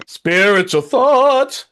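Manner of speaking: very slow, high unearthly intoning; spirit voice. Pretend. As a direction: moan it like a ghost. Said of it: "Going to spirit voice there".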